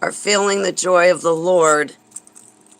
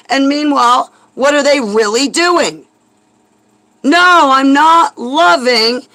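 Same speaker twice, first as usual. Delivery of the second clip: angry rant; 5 semitones higher, 5 dB louder than usual. Real, not acted. Angry rant about loving enemies.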